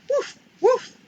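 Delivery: high, loud yappy puppy woofs; puppy bark. Acted. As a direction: bark like a small eager puppy. Puppy barks.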